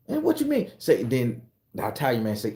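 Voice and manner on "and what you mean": very high question. Acted.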